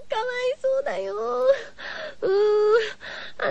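high, tearful whimper; mock crying. Radio host pretending to weep for the dead captain, hammed-up sobbing. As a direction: play up the sobbing over the poor boy.

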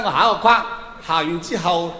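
normal talking voice

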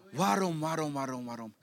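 low, soft droning repetition; dull, unvarying mumble; monotone. Acted. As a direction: drone it on one low note, fading away. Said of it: "Laat je leven niet monotoon worden".

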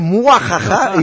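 mock villain cackle; short theatrical evil laugh; macabre laugh. Shown as a demonstration. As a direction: throw in a comic sinister "muajaja". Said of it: "como una risa macabra".

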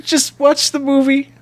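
high, strained, tearful plea; hammed-up crying; mock sobbing plea.

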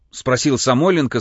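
usual speaking voice

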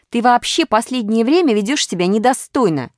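high, loud reproach; scolding. A narrator's reading. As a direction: tell him off indignantly. Pyatyorka scolds Minus.

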